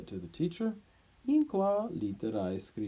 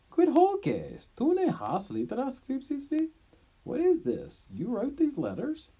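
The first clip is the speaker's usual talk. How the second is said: stunned disbelief at the tablet; shocked; 6 dB louder than usual. Acted. Teacher Diodorus confronts Sextus over the letters, shocked.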